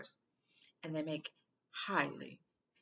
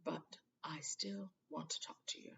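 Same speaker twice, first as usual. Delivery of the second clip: somber hush; 8 dB quieter than usual. A narrator's reading. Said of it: "his face was somber again".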